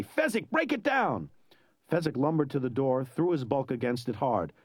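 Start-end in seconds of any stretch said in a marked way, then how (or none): 0.0-1.3 high, drawn-out urgent plea; desperate call. A narrator's reading. Inigo begs Fezzik to break the door, desperate. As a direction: call for help desperately, stretching the words. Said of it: "he called out desperately".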